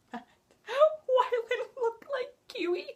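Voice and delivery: high, slow, breaking into laughter; laughing. Real, not acted. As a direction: laugh through it, can barely get it out. Laughing while recalling the scene.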